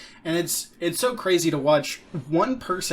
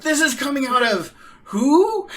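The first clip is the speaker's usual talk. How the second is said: laughing, much higher astonished voice; stunned disbelief; 11 semitones higher, 7 dB louder than usual. Retold or imitated. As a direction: imitate someone wide-eyed and amazed. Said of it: "He was like".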